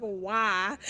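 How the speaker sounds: put-on loud, crying Southern accent wail; despairing Southern belle cry. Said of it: "I love your southern accent as this character".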